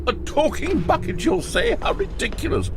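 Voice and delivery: high squeaky mock bucket voice. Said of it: "The bucket spoke".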